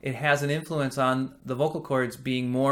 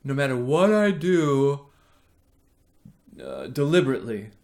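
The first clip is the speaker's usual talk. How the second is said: breathy voice. Shown as a demonstration. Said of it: "So if I'm like this".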